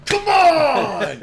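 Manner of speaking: crazed fan yelling at a celebrity; crazy yelling. Pretend. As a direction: scream it like an unhinged fan.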